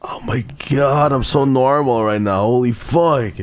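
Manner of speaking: high-pitched frantic wail; worked-up, high and stretched; overwhelmed outburst. Real, not acted. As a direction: insist on it in a high squeal. Ironic outburst, overwhelmed by game.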